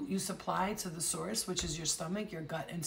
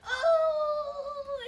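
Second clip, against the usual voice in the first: long, high mock wail; drawn-out squeal of mock anguish; crying wail; 8 dB louder than usual. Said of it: "it's crying".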